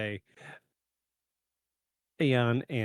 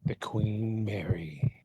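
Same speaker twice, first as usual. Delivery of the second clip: slow, hushed horror host intro; spooky narrator; 2 semitones lower than usual. Acted. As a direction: draw listeners into a ghost story.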